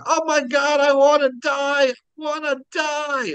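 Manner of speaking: high-pitched mock scream, drawn out at the end; imitated screaming. Dad imitating his son screaming on the drop ride. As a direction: scream it like a panicking child. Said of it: "and then he was screaming".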